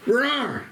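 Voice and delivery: high-pitched mock bear roar; little boy's high "rawr". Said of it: "act like a ring bearer".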